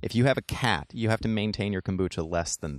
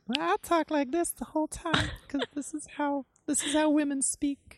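high put-on falsetto parody of a woman; gender-flipped satire voice, much higher; mock woman voice. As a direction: squeak it out like a man parodying women. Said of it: "gender bender episode".

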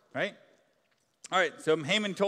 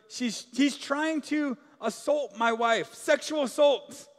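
higher, slow outraged accusation; king calling out; 7 semitones higher, 4 dB louder, slower than usual. A narrator's reading. King accusing Haman before the attendants, outraged. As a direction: cry out the accusation. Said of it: "he calls".

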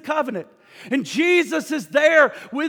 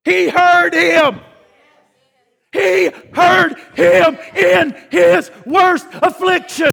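loud, slow, high-pitched proclamation; thundering revival-preacher peak; full-force preaching; 6 semitones higher, 8 dB louder, slower than usual. Acted. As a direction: hammer home that God heard.

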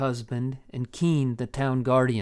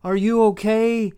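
frantic calling; 9 semitones higher, 6 dB louder than usual. Acted. Levi fearing for Rebecca, frantic high call. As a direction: plead across the yard.

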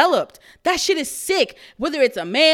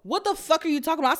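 retold shout; 3 semitones higher, 9 dB louder than usual. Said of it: "I said".

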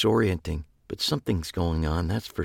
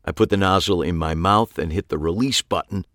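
plain reading voice

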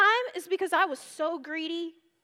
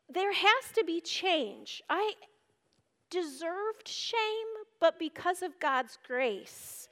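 ordinary delivery

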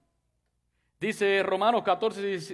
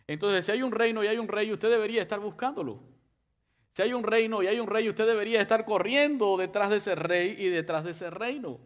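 fervent preaching; 4 semitones higher than usual. Acted. Preacher urging the church to seek the king, fervent and raised. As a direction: drive the call home to the church.

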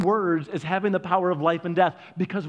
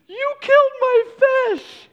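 high, drawn-out bawling shout; mock bawling; 8 dB louder, slower than usual. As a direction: imitate a little girl wailing an accusation. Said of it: "bawling, and she looks at Dad".

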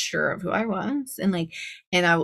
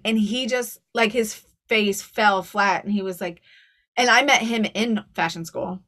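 usual speaking voice

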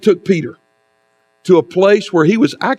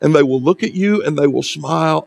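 normal talking voice

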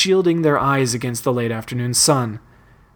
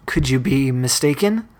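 ordinary delivery, nothing marked

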